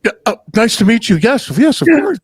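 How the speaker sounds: high, fast, gushing; mock gushing polite greeting. Retold wife meeting the mom, high and gushing. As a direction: pile on the eager pleasantries. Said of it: "She's like".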